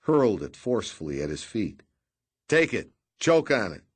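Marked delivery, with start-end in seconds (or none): 2.5-3.8 higher and louder, hurling the words; furious shout. A narrator's reading. Redrick throws money at the captain's feet, furious shout. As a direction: throw it at them in fury.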